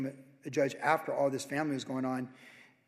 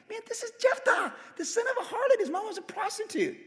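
warning the bullies about Jephthah; excited hype. Acted. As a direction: build him up like a fight announcer. Hyping up Jephthah's tough image.

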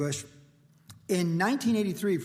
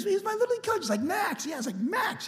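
high-voiced recollection. Imitating coach and himself.

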